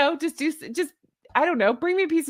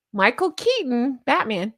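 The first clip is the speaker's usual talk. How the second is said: normal talking voice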